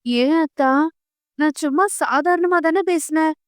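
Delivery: mildly pitiful, pleading upward; plaintive. Acted. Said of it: "அவள் பாவமாக கேட்க".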